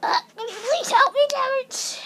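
high-pitched little plea; pleading pretend voice. Figure asking Terrence for help, high pleading voice.

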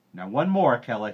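loud, high call easing at the name. A narrator's reading. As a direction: cry out a command to a helper. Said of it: "he cried".

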